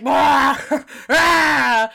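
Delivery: high, loud mock wail; weird creature shriek. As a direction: screech like a leaf-faced creature. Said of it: "he's like".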